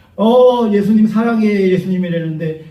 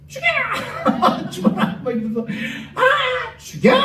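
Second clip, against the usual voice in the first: mock mob yell; slower than usual. Saojeong yelling while throwing stones.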